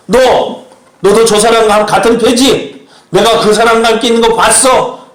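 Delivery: loud, high-pitched acted accusation; acted indignant challenge; accusing confrontation. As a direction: confront a suspect, raising voice and pitch. Acts out Peter's accusers confronting him.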